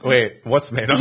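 ordinary talk, nothing marked

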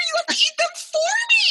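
high, loud, pleading shout; loud playful demand. Real, not acted. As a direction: yell it at her half-laughing. Pleading loudly over biscuits.

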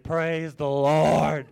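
loud, slow cry of relief; relieved praise. Retold or imitated. Let off by the officer, loud slow relieved praise. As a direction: stretch it out in huge relief.

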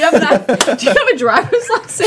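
high, laughing mock interrogation; laughing mock question. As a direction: crack up while pretending to demand ID.

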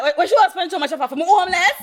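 high, quick mimicry with laughter in it; imitating indignant man. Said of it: "And he's like".

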